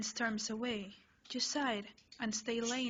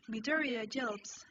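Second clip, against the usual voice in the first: ordinary delivery, nothing marked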